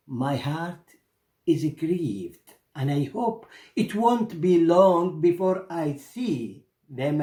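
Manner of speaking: caged bird telling merchant her sorrowful message, slow and longing; grieving caged bird. Acted.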